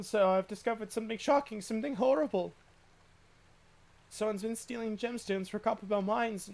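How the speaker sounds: breathless urgent report to the captain; guard alarmed, reporting to his captain. Acted. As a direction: burst in with alarming news. Guard reports shocking news urgently.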